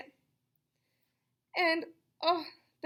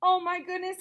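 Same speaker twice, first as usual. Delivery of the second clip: loud surprise; 8 semitones higher, 8 dB louder than usual. Real, not acted. Reacting to twist ending.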